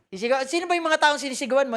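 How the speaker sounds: normal talking voice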